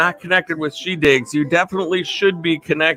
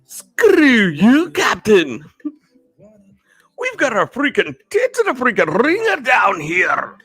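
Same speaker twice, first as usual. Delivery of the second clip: put-on Scotty, Scottish accent; 6 semitones higher than usual. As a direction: holler in a cartoonish Scottish brogue. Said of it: "that's my Star Trek Scotty".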